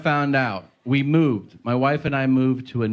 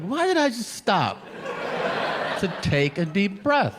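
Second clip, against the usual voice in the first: high self-mocking voice; 6 semitones higher, slower than usual. Self-scolding inner voice.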